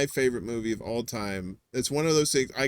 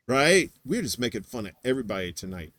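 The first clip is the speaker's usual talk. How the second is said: ordinary talk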